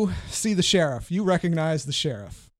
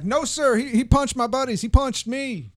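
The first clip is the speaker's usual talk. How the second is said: Jebediah; 6 semitones higher, 5 dB louder, slower than usual. Acted. Jebediah accuses the player to the sheriff, high indignant complaint. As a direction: plead your case to the sheriff, pitched way up. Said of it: "Is that right, Jebediah? It's like".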